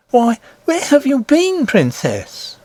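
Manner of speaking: high, bright-pitched worried nurse voice. Acted. Nurse scolding the princess for hiding, high voice.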